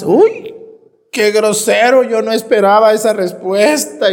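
squeaky high gasp of offense, then huffy; mock offended lady. Priest mocking a parishioner offended by his reply, shrill and huffy. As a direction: imitate a prim lady shocked by the answer.

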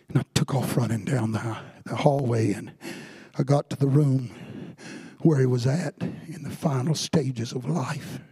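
usual speaking voice

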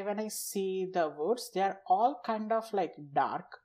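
ordinary talk, nothing marked

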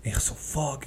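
usual speaking voice

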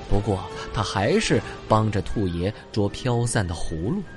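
ordinary delivery

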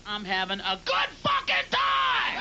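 high, slow party yell; mock party shout. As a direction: shout it like the shirt is talking. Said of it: "this fashion statement says".

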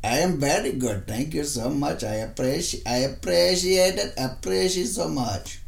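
hammed-up Indian accent; mock grateful thanks in an Indian accent; put-on Indian accent. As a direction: imitate an Indian accent, playfully. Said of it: "Indian accent".

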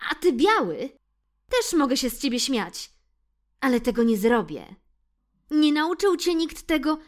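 high, loud, earnest lecturing voice; brave blue friend. Acted. Blue lectures the cats, high earnest voice. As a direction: face the cats and reason with them. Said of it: "Blue powiedział na to".

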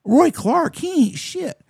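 high, mocking voice as his younger self; mock scoffing. Son mocking his dad's hero, high and scoffing. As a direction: squeak out the scorn like a cocky twenty-year-old. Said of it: "I said".

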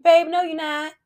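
loud high "Babe, no"; sassy retort. Real, not acted. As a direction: shut it down with a snap.

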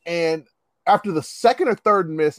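ordinary talk, nothing marked

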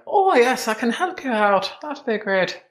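high-pitched Irish travel agent lady; Irish woman. Retold or imitated. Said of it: "'Cause it's an Irish woman".

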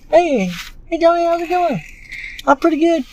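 falsetto friendly hey; chirpy high greeting voice; high-pitched casual greeting. Retold or imitated. Mimicking the guy again.

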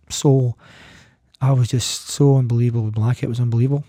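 ordinary talk

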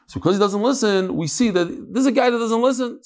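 usual speaking voice

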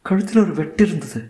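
high woman's voice, upset; distressed young woman. Acted. Young woman grieving aloud to two lawyers over the dead girl, distressed. Voices Nirupama reporting the wound.

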